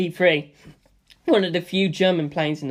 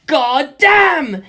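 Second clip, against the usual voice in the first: high, loud startled yell; a loud cry of shock; yelled curse; 9 semitones higher, 7 dB louder than usual. A narrator's reading. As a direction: shout it out while clinging on. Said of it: "I yelled".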